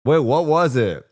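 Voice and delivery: usual speaking voice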